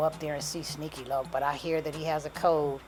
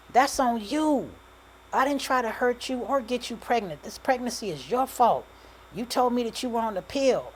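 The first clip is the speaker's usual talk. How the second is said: higher, louder accusation; angry blaming; 8 semitones higher, 5 dB louder than usual. A narrator's reading. Man blaming his pregnant ex in a quarrel, angry. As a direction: throw the blame back, raised voice.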